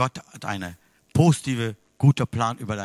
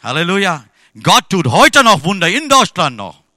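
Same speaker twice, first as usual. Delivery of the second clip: louder, higher proclaiming praise; full-force preaching; 4 semitones higher, 11 dB louder than usual. Acted. Preacher proclaims God's miracles to the church, full force. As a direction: ring it out to the congregation.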